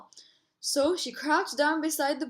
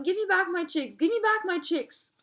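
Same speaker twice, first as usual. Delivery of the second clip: storybook yell; 6 semitones higher than usual. A narrator's reading. Mother ostrich chasing the lioness, high mock yell. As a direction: call it out high and insistent, stopping short of a real yell.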